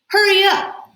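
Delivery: high, loud urging; the boy hurrying the widow's son, high and loud. Acted.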